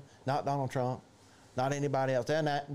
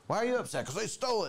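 mock exchange; 8 semitones higher, faster than usual.

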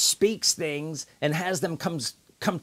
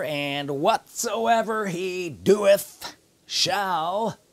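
ordinary talk

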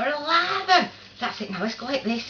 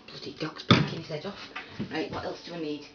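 usual speaking voice

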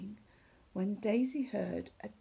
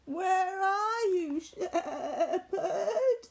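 higher, louder, slow frightened little sheep; frightened bleat; 9 semitones higher, 4 dB louder, much slower than usual. Acted. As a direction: call out slowly for the lost shepherd, scared. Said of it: "Daisy bleated in alarm".

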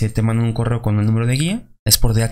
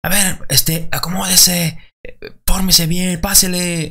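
higher, bossy guard barking orders; brusque embassy guard; 7 semitones higher, slower than usual. Retold or imitated. To visa applicants, a guard snapping orders in line. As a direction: order people into line like an impatient officer. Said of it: "la gente que está atendiendo es muy eufórica".

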